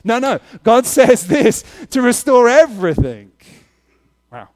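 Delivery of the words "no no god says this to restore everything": loud, high-pitched preacher's shout; emphatic correction. Shown as a demonstration.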